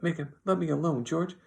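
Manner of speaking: high, loud frightened plea; terrified cry. A narrator's reading. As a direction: plead loudly in a high, scared voice. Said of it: "he cried".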